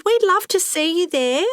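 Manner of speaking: high-pitched call after departing visitors; mild shout. A narrator's reading. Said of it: "Lucy shouted".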